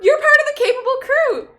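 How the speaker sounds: high and loud, thrilled to recognize them; excited recognition. Acted. Recognizing the famous heroes, delighted and loud.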